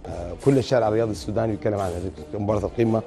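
ordinary talk, nothing marked

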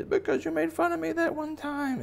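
very high squeaky bunny voice; aggrieved baby bunny. Acted. Bunny telling the city its grudge, high squeal. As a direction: complain as a tiny monster, very high. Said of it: "baby bunny is like".

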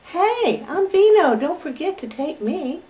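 voicing the little puppy. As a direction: speak for the puppy in a high cute voice. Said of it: "He said".

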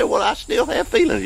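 high whiny objector; mocking whine, way up high. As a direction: pipe up like a doubting listener. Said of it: "You say".

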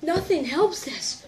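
slow laughing line through the burn. Real, not acted. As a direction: laugh out the complaint. Repeating line while laughing.

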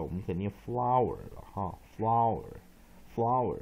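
ordinary talk

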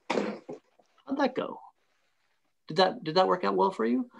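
hushed, drawn-out sensei voice; a gently probing inner teacher; calm wise martial arts master. Pretend. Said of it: "a little sensei voice ... says".

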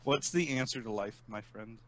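ordinary talk

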